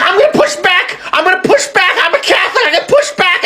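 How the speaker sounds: very high, petulant voice through laughs; mocking whiny Vance voice.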